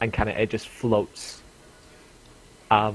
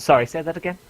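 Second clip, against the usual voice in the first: merchant woman voice; 7 semitones higher, 7 dB louder, much faster than usual. Acted. Merchant asks after voice spell.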